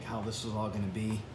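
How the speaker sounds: normal talking voice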